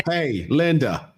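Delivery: slow, high robotic greeting; a hammed-up smart speaker voice; mock smart speaker. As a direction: drawl it like a gadget. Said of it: "They're like".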